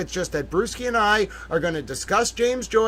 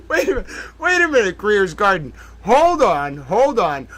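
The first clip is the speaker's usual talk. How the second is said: sudden realization. Real, not acted. Host realizing his mistake, startled. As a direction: catch yourself mid-thought.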